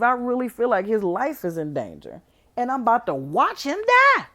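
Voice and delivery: heated rant. Real, not acted. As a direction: rant loudly in disbelief. Indignant rant about the movie.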